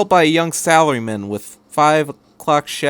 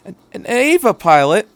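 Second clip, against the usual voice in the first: higher, louder startled squeak of a question; voice quacking a little; 8 semitones higher, 5 dB louder than usual. A narrator's reading. Mother stunned to meet an Eva pilot, voice cracking. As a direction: let the voice crack in shock. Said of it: "voice quacked a little".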